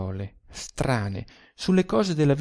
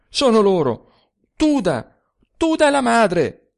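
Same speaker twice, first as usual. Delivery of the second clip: put-on louder and higher, a drunken cry; shouting; 8 semitones higher, 6 dB louder than usual. A narrator's reading. Drunk narrator shouts at his friend across the table. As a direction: blurt out the shock at full voice.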